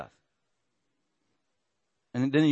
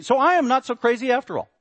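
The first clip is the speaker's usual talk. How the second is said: vindicated; 9 semitones higher, 6 dB louder than usual. Real, not acted. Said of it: "vindicated preacher".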